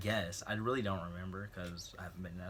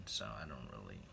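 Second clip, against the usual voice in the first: muttered aside; 11 semitones lower, 10 dB quieter than usual. Real, not acted.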